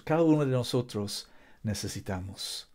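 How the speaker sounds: usual speaking voice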